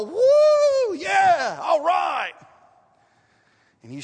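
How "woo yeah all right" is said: loud, high jubilant cheering from up ahead; joyful whoop. Retold or imitated. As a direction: whoop it up like someone who just got good news. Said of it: "you hear somebody go".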